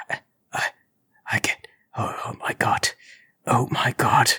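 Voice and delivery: shaky, broken whisper; falling apart, whispering in terror; trembling whisper. Acted. Intruder whispering as he drops a jar, lip trembling. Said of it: "his lip trembling".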